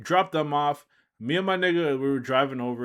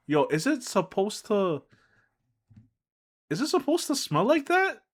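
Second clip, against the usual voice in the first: surprised; 9 semitones higher than usual. Retold or imitated. Friend's naive question, retold.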